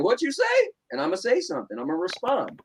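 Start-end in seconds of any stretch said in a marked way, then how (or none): 0.0-0.7 sharply higher "what you say", squaring up; confrontational challenge. Retold or imitated. Imagined comeback to an insulting viewer, high and confrontational. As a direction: snap back like someone just crossed you. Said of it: "I'm gonna be like".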